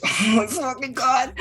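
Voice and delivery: high, exasperated groan of a fed-up man; exasperated customer. Acted. Customer behind them losing patience, high and exasperated. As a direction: let it burst out in impatience. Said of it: "The guy behind you is like".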